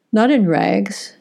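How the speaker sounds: usual speaking voice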